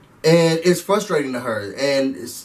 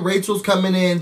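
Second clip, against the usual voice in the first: ordinary talk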